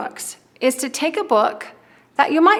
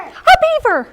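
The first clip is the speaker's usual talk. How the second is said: excited; 7 dB louder, slower than usual. Real, not acted. Teacher praising preschool answer.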